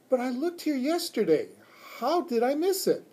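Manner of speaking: put-on high-pitched baffled girl voice; high, astonished schoolgirl wondering; amazed child. A narrator's reading. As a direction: marvel in disbelief like a child. Puzzled girl wonders at found watch.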